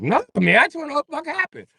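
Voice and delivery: high-pitched rushed explanation. Retold or imitated.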